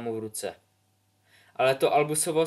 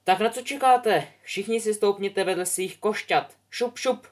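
much higher, louder bossy teacher voice; brisk flying teacher; 9 semitones higher, 4 dB louder than usual. Acted. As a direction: hurry the class along. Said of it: "popoháněla je madam Hoočová".

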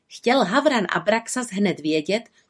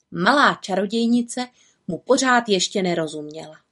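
usual speaking voice

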